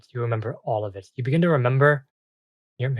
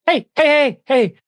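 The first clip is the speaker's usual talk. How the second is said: high, loud, piping little fairy call; high fairy voice trying to get attention; soft-voiced ice fairy; 10 dB louder than usual. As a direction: call out as a small fairy, pitched way up. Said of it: "of a very soft voice".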